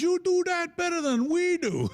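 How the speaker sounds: high-pitched indignant shout; mock exclamation. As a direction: cry out in hammed-up disbelief. Elevator man exclaims at the impression.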